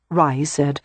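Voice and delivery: usual speaking voice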